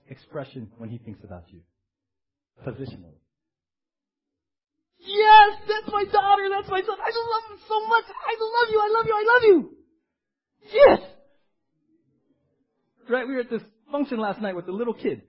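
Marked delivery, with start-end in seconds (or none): 5.0-11.0 very high, excited squeal; hammed-up squealing adoration; gushing proud parent. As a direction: gush in falsetto over a beloved child. God as doting excited parent.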